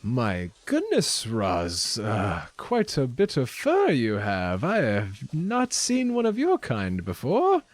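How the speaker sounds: put-on accented shopkeeper marveling, swooping high; shopkeeper Lenine. Shopkeeper welcomes furry stranger to town, lilting accented wonder.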